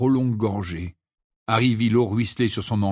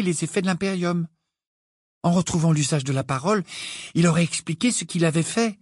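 put-on high-pitched girl, reasoning eagerly; Hermione's earnest voice; 7 semitones higher than usual. A narrator's reading. Hermione explaining her theory to Harry and Ron, earnest. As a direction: lift the pitch and lay out the deduction.

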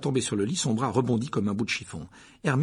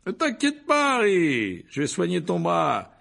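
high, loud, showy teacher's voice; vain teacher; 8 semitones higher, 5 dB louder, slower than usual. Acted. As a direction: preen and reassure at full volume. Lockhart voiced by narrator.

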